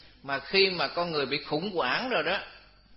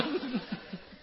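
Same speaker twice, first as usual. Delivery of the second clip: short high real laugh at himself; real chuckle; 10 semitones higher, 5 dB quieter than usual. Real, not acted. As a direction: laugh it off, a little embarrassed. Laughs at own fear of ghosts. (tiếng cười khẽ, tự giễu)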